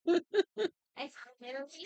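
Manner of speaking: high, slow dog voice through a laugh; voicing the dog, laughing. Owner laughs while speaking as his dog in a high voice. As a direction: giggle it out as the dog.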